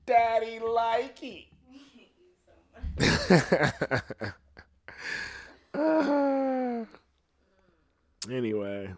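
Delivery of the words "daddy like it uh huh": creepy put-on voice. Joking creepy voice after "I like it.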